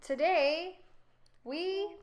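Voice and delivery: high, loud announcing; grand proclamation. A narrator's reading. King's proclamation.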